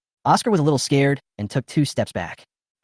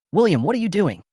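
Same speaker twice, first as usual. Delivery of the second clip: a higher, raised angry call across the room; narrated angry cry, only moderately voiced; angry outcry; 8 semitones higher than usual. A narrator's reading. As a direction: sister storms over and demands an answer. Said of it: "ran over angrily".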